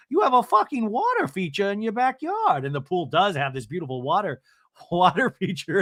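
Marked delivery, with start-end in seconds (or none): 0.1-2.6 high, louder sassy impression; mock Gina. Host mimicking Gina teasing about the water feature, high and sassy. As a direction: sass it with mock envy. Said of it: "Gina the Tucking goes".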